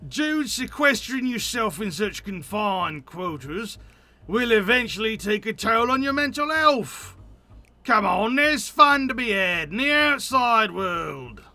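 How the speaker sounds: lightly voiced pushy optimist; cheery friend. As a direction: coax a shut-in outdoors, hammed up. Voices upbeat NPC Jeremy.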